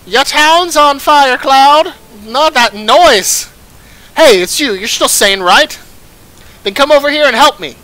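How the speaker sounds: loud, high alarmed villager voice; urgent calling out. Acted. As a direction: shout for help over a town fire. Villager calling out in burning town.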